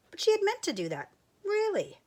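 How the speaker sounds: high, fast, playfully defensive; mock insistence. A narrator's reading. Narrator teasing Sheep's clumsy excuse, mock insistence. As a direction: insist you meant it, unconvincingly.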